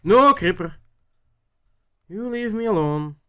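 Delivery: high, loud shout at a creeper; panicked yell. Real, not acted. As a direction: yell at the monster to back off. Creeper attacks, yells at it.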